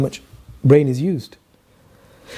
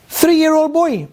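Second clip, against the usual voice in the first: high-pitched emphatic astonishment; amazed; 8 semitones higher, slower than usual. Real, not acted. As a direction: lift it high in sheer amazement. Marvelling at the child's feat.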